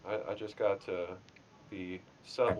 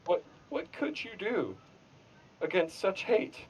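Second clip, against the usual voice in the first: mock anguish; 8 semitones higher, 5 dB louder than usual. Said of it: "it's like".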